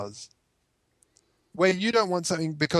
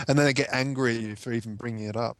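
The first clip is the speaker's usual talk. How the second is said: usual speaking voice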